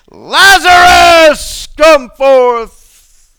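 very high, loud, slow shout; Jesus shouting into the tomb; commanding shout. Acted. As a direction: call a dead man out of the tomb. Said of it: "said to a dead man".

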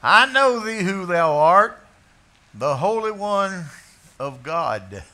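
loud, high, strange voice, stress on know; a possessed man crying out; demon's confession. Acted. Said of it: "Now, this isn't a visiting preacher".

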